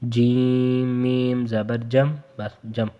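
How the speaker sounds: slow جم with a plain م; no nasal hold on جم; no ghunna. Shown as a demonstration. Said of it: "غنہ نہیں ہوگا".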